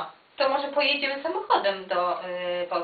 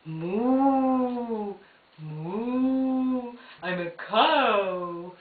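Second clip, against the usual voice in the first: cow voice; 2 semitones lower, 3 dB quieter, much slower than usual. Acted. Voicing a toy cow.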